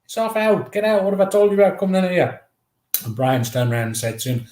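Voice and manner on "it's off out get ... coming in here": high, fast mock shouting; hotel bloke chasing kids. Hotel doorman shooing two scruffy kids from the lobby, high mock yelling. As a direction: shoo the kids out in a high yell. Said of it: "he's like".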